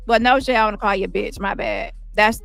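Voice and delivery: normal talking voice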